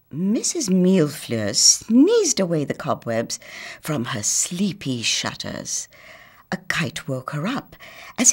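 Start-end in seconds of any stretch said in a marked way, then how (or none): none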